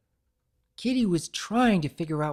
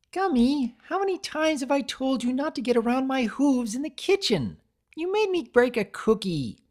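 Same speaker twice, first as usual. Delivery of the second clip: high, louder scolding voice; scolding creator; 11 semitones higher, 5 dB louder than usual. A narrator's reading. Creator scolds the pet for making her break a cookie, high and louder.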